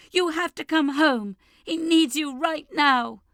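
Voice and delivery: high, loud and urgent, slowing at the end; a frantic scream that drags on the last words. Acted. Sister screams that mum must come home now. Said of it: "her sister is screaming".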